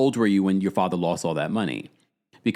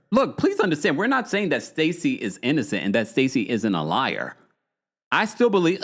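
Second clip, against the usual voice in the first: ordinary talk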